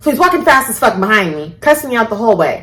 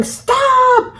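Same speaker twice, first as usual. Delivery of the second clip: imitated yell. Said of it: "she's in the car yelling".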